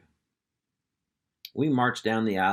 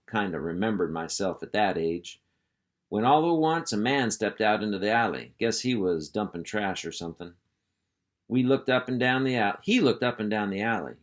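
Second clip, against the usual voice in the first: plain reading voice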